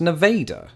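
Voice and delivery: "Nevada" with a long "ay" in the middle; Iowa town pronunciation. Shown as a demonstration. Naming the city in Iowa, "Nevada" said Ne-VAY-da. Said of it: "There's a city in Iowa with the same name".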